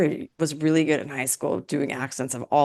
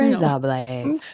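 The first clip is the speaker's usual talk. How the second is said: slow, drawn-out, lower Australian accent; Australian accent attempt; 5 semitones lower, much slower than usual. Shown as a demonstration. Host asks who can do Australian, attempted Australian accent. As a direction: put on an exaggerated Australian accent, slowly. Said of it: "do an Australian accent".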